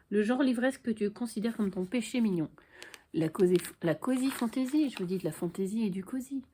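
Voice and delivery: ordinary talk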